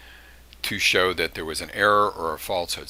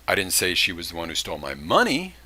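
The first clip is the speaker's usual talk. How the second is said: "money" stressed, falling at the end. Shown as a demonstration. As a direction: land hard on "money". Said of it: "she did steal something, but she didn't steal money".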